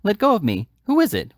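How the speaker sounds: high, loud panicked protest; struggling cry. A narrator's reading. Oliver struggles in the stranger's grip, crying out in panic. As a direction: fight free of the arms, calling out. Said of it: "cried Oliver, struggling".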